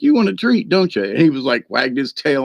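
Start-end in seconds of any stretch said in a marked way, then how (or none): 0.0-1.0 talking to the dog. Retold or imitated. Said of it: "I was like".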